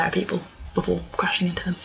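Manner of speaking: hushed unvoiced aside; whisper. Real, not acted. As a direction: whisper it conspiratorially.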